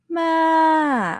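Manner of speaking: "Ma" very high, swooping up and down; overdone rise-fall on "Ma"; wrong rise-fall tone. Retold or imitated. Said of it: "someone teaches".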